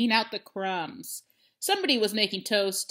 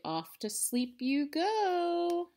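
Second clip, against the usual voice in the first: lullaby voice; 8 semitones higher, much slower than usual. Acted. Lulling the pig to sleep.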